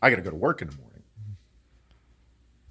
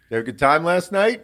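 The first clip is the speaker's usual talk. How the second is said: probing question; 8 semitones higher, 8 dB louder than usual. Retold or imitated. Dad questioning him, acted.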